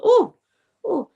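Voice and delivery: high, loud shocked oh; startled gasp. Retold or imitated. Doctor walking in on exposed patient, startled oh. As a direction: caught off guard, mock startled.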